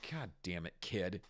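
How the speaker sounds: soft, low exasperated groan; annoyed cop grumble. Retold or imitated. Cop handling a charging kid, a soft, annoyed grumble. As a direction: mutter it like a put-upon cop. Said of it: "He's just like".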